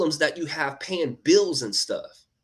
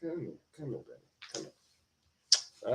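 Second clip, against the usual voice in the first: soft coaxing; 8 dB quieter than usual. Real, not acted. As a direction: coax the little one over tenderly. Calling softly to child.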